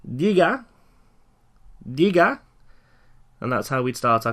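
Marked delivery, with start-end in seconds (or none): 0.1-2.4 "diga" raised and loud with a rise; teacher modelling a phone answer; phone greeting model. Shown as a demonstration. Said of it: "So we have".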